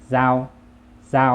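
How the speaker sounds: เจ้า as Vietnamese, with a held vowel; Vietnamese pronunciation. Shown as a demonstration. Teacher models the word for knife, Vietnamese pronunciation of เจ้า. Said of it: "ภาษาเวียดจะว่า".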